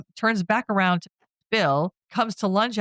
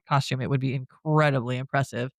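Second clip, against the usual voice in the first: ordinary delivery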